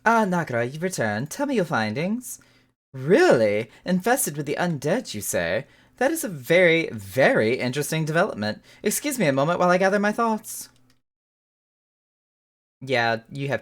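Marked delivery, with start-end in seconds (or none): 0.0-10.7 eager scholar voice. Acted. Reads NPC scholar's lines.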